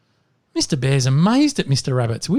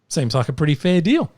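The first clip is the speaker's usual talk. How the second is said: ordinary talk, nothing marked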